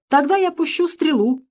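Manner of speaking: plain reading voice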